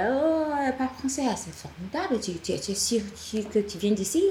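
imitating amazed French speakers, high-pitched; hayret. Acts out surprised French speakers.

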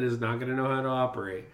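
ordinary talk, nothing marked

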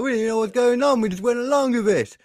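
mock rioter. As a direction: play a sheepish rioter shrugging it off. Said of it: "a few of them admitted that".

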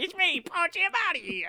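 high-pitched, hammed-up character voice; kicked-up silly actor voice; Paul Giamatti impression. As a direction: squeal the name as a comic impression. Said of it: "I'll kick it up a notch".

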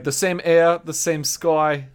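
mock New York American accent, last word drawn out; exaggerated copy of a put-on American accent; imitated bad American accent. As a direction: parrot a Brit's attempt at New York American. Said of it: "you were like".

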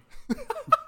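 high-pitched giggle; a squeaky burst of laughter. Real, not acted. Laughs at own joke.

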